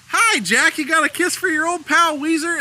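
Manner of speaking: high, hammed-up hello to a child; playful imitation of an overeager old pal; coaxing Weezer greeting. Acting Weezer greeting small boy.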